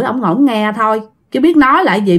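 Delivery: ordinary delivery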